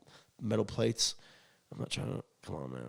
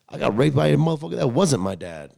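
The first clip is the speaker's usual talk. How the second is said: ordinary delivery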